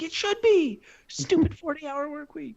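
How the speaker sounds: mock whine. Retold whiny reply to wife.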